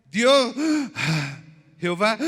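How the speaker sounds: hammed-up pulpit cry of the name; mock preaching shout. Shown as a demonstration. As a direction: call it out like a fiery preacher. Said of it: "si yo voy a predicar acá y decir".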